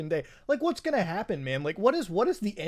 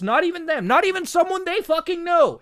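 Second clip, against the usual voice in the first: ranting shout; 11 semitones higher, 8 dB louder than usual. Real, not acted. Ranting escalation.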